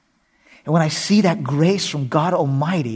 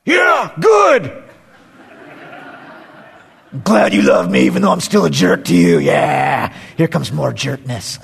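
sarcastic dad; 7 dB louder than usual. Retold or imitated. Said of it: "how do you think I responded".

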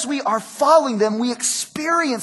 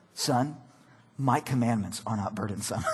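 much lower, softer, mock-grave pronouncement; mock solemn decree; 10 semitones lower, 12 dB quieter than usual. Father to candy-eating son, mock-grave and low. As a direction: intone it like a grave biblical father. Pastor quotes God to son.

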